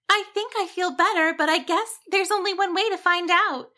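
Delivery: high sheep voice, lightly cheerful; relieved sheep thanks the dragon. A narrator's reading. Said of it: "Cassandra said cheerfully".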